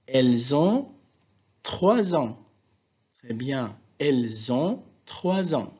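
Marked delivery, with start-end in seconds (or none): 0.0-2.4 Z links in "Elles ont trois ans"; liaison. Shown as a demonstration. Teacher pointing out another liaison, Z linked in "Elles ont trois ans". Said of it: "il y a une autre". 4.0-5.7 "Elles ont trois ans" with linking Z sounds; liaison. Shown as a demonstration. Teacher repeating the exercise answer, Z linked in "Elles ont trois ans". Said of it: "il y a une autre".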